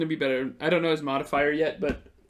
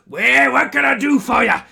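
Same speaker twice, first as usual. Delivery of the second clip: loud, higher folksy barkeep greeting; husband bursting in, higher and loud; innkeeper answering; 8 semitones higher, 11 dB louder than usual. Acted. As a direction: boom it out like a hearty innkeeper. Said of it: "And he goes".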